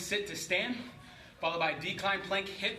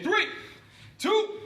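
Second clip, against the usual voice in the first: shouted countdown; 12 semitones higher, 5 dB louder, much slower than usual. Real, not acted. Coach counting down the set.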